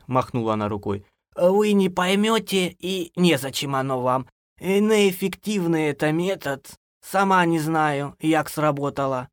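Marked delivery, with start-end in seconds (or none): 1.3-9.3 put-on high, slow old woman's voice; dismissive granny. A narrator's reading. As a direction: brush them off, drawing it out. (бабушка отмахивается, высоко и медленно)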